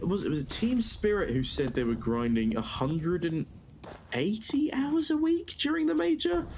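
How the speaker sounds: normal talking voice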